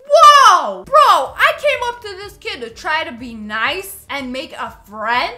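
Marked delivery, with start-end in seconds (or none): 0.1-0.8 loud startled yelp; startled whoa. Real, not acted. Player yelps at the rude kid's reply, startled shout. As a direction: shout in shock.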